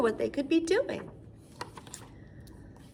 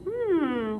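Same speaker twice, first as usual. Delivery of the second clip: ordinary talk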